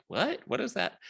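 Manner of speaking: high, puzzled disbelief; mock bewildered double-take; baffled what. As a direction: act confused by a strange word. Guests baffled by Teamzy.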